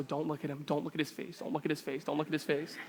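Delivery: low, hushed repeated self-warning; embarrassed self-talk. Retold or imitated. Teenage son avoiding dad's face, embarrassed hushed self-talk. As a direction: chant it under your breath, imitating shame. Teen avoids dad after the explosion.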